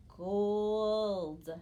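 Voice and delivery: gold said loud and drawn out, a clear teaching model; emphatic model word. Shown as a demonstration. Teacher calling out gold for children to repeat. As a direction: call out the word gold clearly for the class to echo. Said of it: "Let's add gold".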